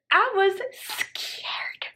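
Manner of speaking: very drawn-out, high, whispery whimper; hammed-up fright. Storyteller reliving being trapped, drawn-out whispery mock fear. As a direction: stretch 'scared' into a thin, high mock whimper.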